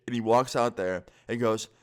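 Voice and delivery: ordinary talk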